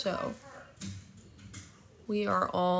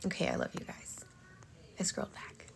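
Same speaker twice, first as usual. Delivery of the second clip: soft whisper; 13 dB quieter, faster than usual. Real, not acted. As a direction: whisper it close to the mic. Whispered aside to viewers.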